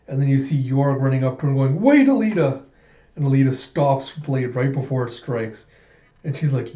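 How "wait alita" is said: high, loud plea to stop; retold panicked shout; urgent cry. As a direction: call out desperately to halt her. Yorg running up, pleading stop.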